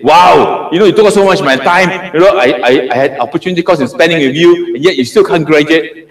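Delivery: playful mock scold of a lagging student. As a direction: act out a fed-up supervisor grumbling at the student. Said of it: "Then I will scold you and say".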